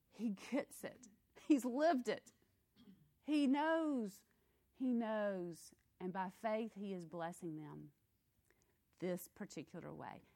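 ordinary talk

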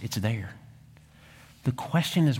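ordinary talk, nothing marked

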